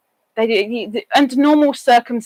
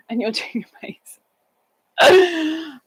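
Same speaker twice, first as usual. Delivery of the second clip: laughing; 5 semitones higher, slower than usual. Real, not acted. Laughing at a friend.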